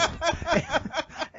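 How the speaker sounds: high burst of real laughter. Real, not acted. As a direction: break up laughing. Laughing at team name.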